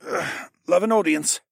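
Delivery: strained, high-pitched quip; groan, then joke through the effort; pained, effortful wryness; grunting. A narrator's reading. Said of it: "he grunted".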